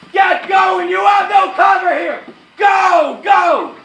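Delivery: high, full-force battlefield yell; screaming orders. Acted. Officer screaming at exposed men to get going. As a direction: drive them on at the top of your voice. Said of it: "screaming at the top of my voice".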